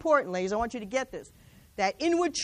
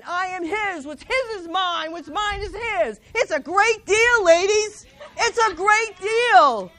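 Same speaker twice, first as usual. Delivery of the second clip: preaching louder and higher, building to joy; rising proclamation; 4 semitones higher, 5 dB louder than usual. Acted. Preacher tells the ladies it's a great deal, exultant. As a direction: lift it up, she's getting excited about God.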